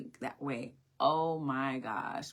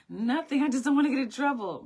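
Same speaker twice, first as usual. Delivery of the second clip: put-on high, louder, rushed child's plea; anxious child; 10 semitones higher, 8 dB louder than usual. Daughter insisting to her mother she did nothing, high and anxious. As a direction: blurt it out like a worried little girl.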